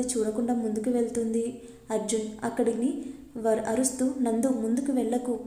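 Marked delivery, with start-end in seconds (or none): none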